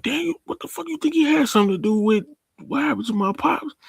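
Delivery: high-pitched, worked-up imitation; mock alarmed disbelief. Kane asking Tariq about his pops, high mock alarm. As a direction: ask it high and agitated.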